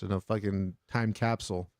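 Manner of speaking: usual speaking voice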